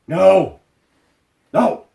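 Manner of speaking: loud, high, short outbursts; real exasperated cry of refusal; emphatic refusal. Real, not acted. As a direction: shoot it down loudly, pitch jumping up. Rejects the suggested names.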